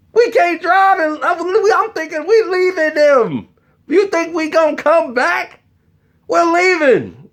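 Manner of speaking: high-pitched mock-frantic rant; high, incredulous retold tirade; exasperated outburst. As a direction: berate your cousin in a squeal. Acted outburst over missing keys.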